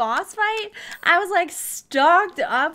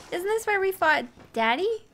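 normal talking voice

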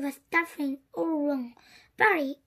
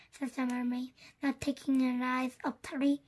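plain reading voice

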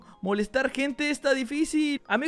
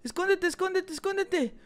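urgent hiding; 5 semitones higher than usual. Acted. Hiding from pursuer with partner.

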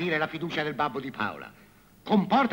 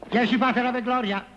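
raised high, loud penance; loud pronouncement; 9 semitones higher, 6 dB louder than usual. Acted. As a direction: proclaim the penance. Priest assigns penance.

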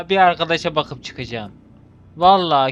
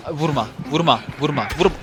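ordinary talk

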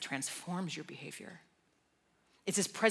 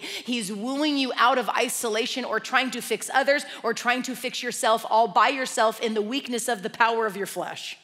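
louder, fervent preaching, slowing on key lines; impassioned preaching; 5 semitones higher, 15 dB louder than usual. Real, not acted. Preacher urges congregation to pray continually, fervent and loud. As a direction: preach it out with full conviction.

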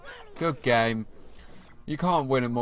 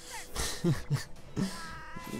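soft, breathy chuckle; 6 semitones higher, 7 dB quieter than usual. Real, not acted. Player amused at his own poor showing, soft breathy chuckle.

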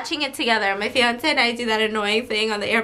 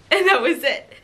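ordinary talk, nothing marked

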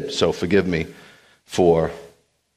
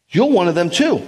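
higher, louder pointed accusation; accusing bystander; 10 semitones higher, 4 dB louder than usual. Acted. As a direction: call him out as one of them.

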